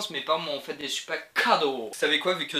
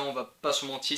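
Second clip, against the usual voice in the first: ordinary delivery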